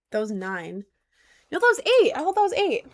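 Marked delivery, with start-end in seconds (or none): 1.5-2.9 indignant protest. Real, not acted. Disputes the count with viewers.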